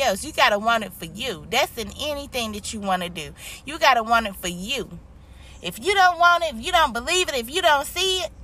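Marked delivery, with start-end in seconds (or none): 5.6-8.3 life coach. Acted. Life coach pep talk, pitch jump.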